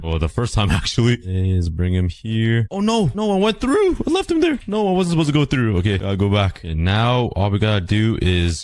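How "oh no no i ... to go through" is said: high panicked squeal; sudden alarm, voice jumping up; real panic at a mistake. Real, not acted. Accidentally went through portal.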